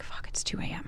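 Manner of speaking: hushed middle-of-the-night curse; retold whisper. Waking alone at night, retold.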